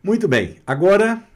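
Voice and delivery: ordinary delivery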